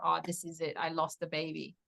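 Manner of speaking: a hushed, defeated inner thought; quiet despair. Retold or imitated. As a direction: murmur it like hope has just gone. Recalling thinking she miscarried.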